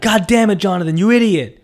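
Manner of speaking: loud, high self-scolding; exasperated retold yell; frustrated outburst. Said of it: "from that frustration".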